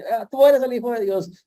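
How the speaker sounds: high, loud mock demon cry. Preacher voicing demons crying out to Jesus, high and loud. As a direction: screech it like a demon recognizing Jesus.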